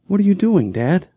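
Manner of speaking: put-on a kid's high, rising plea; scared little brother. Little brother asks his father, high and frightened. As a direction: ask Dad in a small high voice. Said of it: "Sam whispered".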